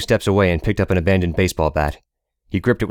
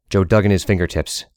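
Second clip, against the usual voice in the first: ordinary delivery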